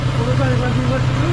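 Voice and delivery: fast, loud call over the motor noise; excited shout over the bike; shouting over engine. Real, not acted. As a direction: raise it high and loud above the engine.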